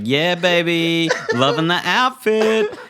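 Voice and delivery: high, slow, stretched-out cheer; hyped approval. Acted. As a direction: hype up a friend, rising as you go. Acts cheering on friend's costume.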